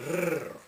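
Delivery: R as a long tongue roll; tongue trill. Shown as a demonstration. Teacher showing viewers the trilled letter R, tongue trill on R. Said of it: "You're trilling with your tongue".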